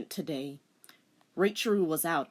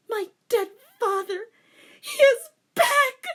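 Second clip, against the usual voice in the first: crying out; 11 dB louder than usual. A narrator's reading. Rei telling her teacher, tearful wail. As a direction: wail it through tears.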